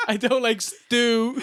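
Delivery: laughing through the stew line; giggling as you repeat it; cracking up mid-quote. Retold or imitated.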